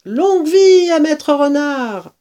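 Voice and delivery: high, slow cheer; cheering toast. Acted. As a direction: cheer the hero.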